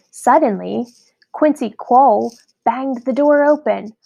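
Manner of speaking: ordinary delivery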